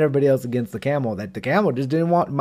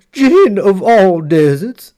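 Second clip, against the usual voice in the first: calling out; 4 semitones higher, 8 dB louder, slower than usual. Acted. Horse addresses the jinn.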